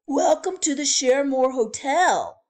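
loud, high, cheery bellhop welcome; chirpy old-fashioned bellhop. Acted. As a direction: call out the welcome like a grand hotel porter. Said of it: "said the bellhop".

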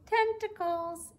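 "tentacles" sung out high and slow; "tentacles" lifted high and slowed right down; playful high drawn-out tentacles. Shown as a demonstration.

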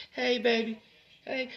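put-on high, soft greeting to a little girl; sweet daddy-to-daughter voice. Shown as a demonstration. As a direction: coo it gently to your daughter. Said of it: "See how my voice went down?".

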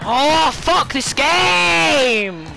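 high, slow, drawn-out curse; frustrated yell. Real, not acted. Gamer cursing the game, frustrated yell. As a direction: stretch the words out in frustration.

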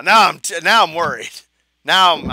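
usual speaking voice

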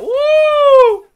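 loud, high drawn-out "wouh"; high whoop. Real, not acted. Streamer reacting in mid-game, high whoop. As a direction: let out a piercing whoop.

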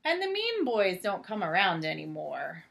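lightly animated storybook narration; reading to children. A narrator's reading. Reading Clifford aloud to a child, gentle storybook voice. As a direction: read it out gently to little ones. Reads picture book aloud.

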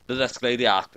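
ordinary delivery, nothing marked

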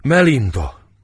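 Melinda called out loud, high, torn between joy and pain; anguished cry. Acted. Izidóra sees Melinda's body, calls out in anguish. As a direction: cry out her name in grief. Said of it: "Öröm s fájdalom között".

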